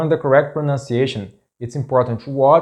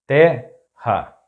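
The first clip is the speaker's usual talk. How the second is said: terra split in two, te - ha; syllable by syllable; 3 dB louder than usual. Shown as a demonstration. Teacher breaking down an example word, terra in separate syllables.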